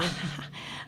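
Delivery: a breathy little laugh under the breath; soft unvoiced chuckle. Principal chuckling awkwardly at the beer remark. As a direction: chuckle quietly, caught out.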